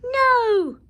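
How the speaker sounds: high, loud frightened no; cried no. A narrator's reading. Witch fleeing the dragon, frightened cry. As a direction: cry out in alarm. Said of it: "cried the witch".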